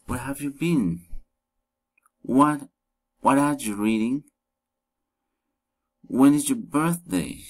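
polite interest, "what are you reading" lifted at the end; friendly rising intonation. Shown as a demonstration. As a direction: ask "When is your birthday" with a friendly rise. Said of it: "rising intonation".

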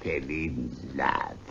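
mocking echo. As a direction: throw his words back with scorn. Scrooge mockingly echoes the nephew.

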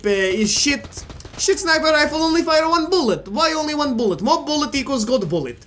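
hammed-up Russian accent, not very good; bad Russian accent. Gamer jokes about Kalashnikov versus sniper rifle, bad Russian accent. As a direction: put on a rough Russian accent. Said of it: "I'm probably doing this accent, like, not good at all".

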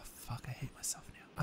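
breathy whispered self-mockery. Real, not acted. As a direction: whisper it to yourself. Muttered to self in game.

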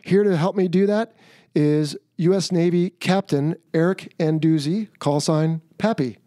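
ordinary talk

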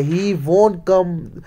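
ordinary talk, nothing marked

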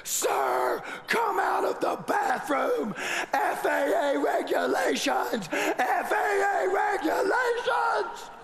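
high, drawn-out frantic shout; frantic steward. Retold or imitated. Flight attendant pounding the bathroom door, shrill panicked shout. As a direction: hammer on the door and plead. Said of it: "banging on the bathroom door".

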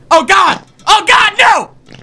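loud, rising cry of alarm; panicked cry. Real, not acted. Player caught by the creature, panicked cry.

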